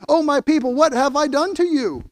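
God pleading with Israel, rising in anguish; God's anguished plea. Acted. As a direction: cry out like a hurt father. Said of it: "Verse three".

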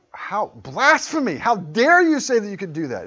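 scribes aghast at Jesus; outraged scribes. Retold or imitated. Scribes accusing Jesus, high loud outraged cry. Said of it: "They're like".

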